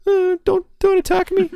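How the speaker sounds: very high, trembling mock whimper; mock a cowering, begging weakling; squeaky pleading falsetto parody. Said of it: "he cowers and says".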